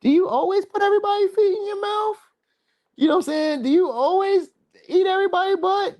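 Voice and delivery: accusing lover. Retold or imitated. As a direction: grill the cheating partner in a high, scandalised voice. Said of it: "you can be like".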